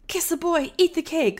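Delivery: put-on high, childlike impulsive spirit, pitched well up. Host imitating the audiobook spirits for her co-host, high childlike voice. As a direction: squeal it like a greedy little spirit. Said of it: "that's how they sounded on the audiobook".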